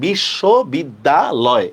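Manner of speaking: বিশ্ববিদ্যালয় slowly split as bish-sho, bid-da, loy; syllable by syllable. Shown as a demonstration. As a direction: sound out বিশ্ববিদ্যালয় in separate slow chunks. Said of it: "এভাবে লেখা".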